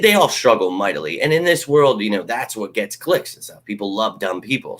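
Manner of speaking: usual speaking voice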